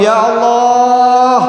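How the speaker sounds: high, very drawn-out cry; dying man's shout. Acted. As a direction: stretch the call out high and long. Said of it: "yang ketiga dia bengok". (teriakan panjang bernada tinggi)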